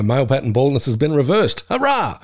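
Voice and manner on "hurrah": high-pitched celebratory cheer; mock triumph. Guest celebrating getting hair in The Simpsons, mock triumphant.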